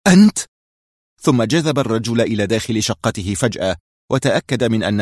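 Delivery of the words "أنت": loud, high cry of shock. A narrator's reading. Shimon cries out recognising Adham, loud and high. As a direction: blurt it out in astonishment. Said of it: "اتسعت عينا شيمون وهتف".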